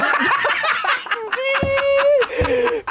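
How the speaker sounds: shrieking high laugh; loud burst of laughter. Real, not acted. Laughing at clown costume.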